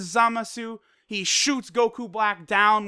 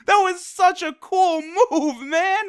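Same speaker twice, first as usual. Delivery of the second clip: high, loud laughing whoa; amazed hype; 12 semitones higher, 5 dB louder, much slower than usual. Real, not acted. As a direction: burst out laughing in hype, slow. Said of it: "And it's like".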